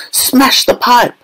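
high, drawn-out frantic yell without much volume. A narrator's reading. Mother yelling for the pipe to be smashed. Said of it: "yelled Missus Gloop".

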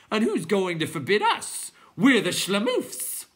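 high, loud creature boast; defiant clown. A narrator's reading. Clown challenging anyone to forbid them, high loud cry.

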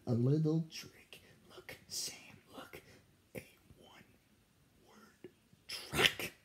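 secretive whisper. A narrator's reading. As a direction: whisper the sneaky trick. Firefly whispers secret trick.

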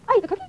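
high-pitched question, lifted on "cookie"; voice up at end. Shown as a demonstration. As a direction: turn the statement into a question by raising "cookie". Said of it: "put their voices up at the end".

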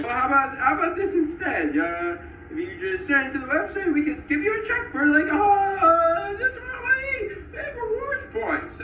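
woman-like guy. Retold or imitated. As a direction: squeak it up like the agent he took for a woman. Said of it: "this guy who I thought was a woman".

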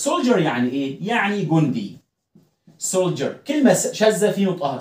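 normal talking voice

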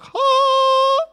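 high, loud choir-like sung ah; angelic sung ah. Retold or imitated. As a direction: sing a heavenly ah. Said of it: "and it's like".

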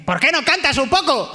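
high, mock call from the crowd; heckling audience member. Audience imitated demanding a song, high mock call. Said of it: "está la gente".

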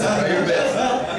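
teasing. Real, not acted. Repeats encouragement slowly, louder.

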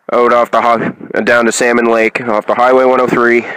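ordinary talk, nothing marked

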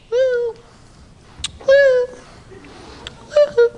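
short high-pitched hoot; attention-getting woo. Retold or imitated. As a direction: imitate a lady hooting for the waiter. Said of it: "trying to get the waiter's attention".

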